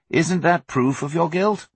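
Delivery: ordinary delivery, nothing marked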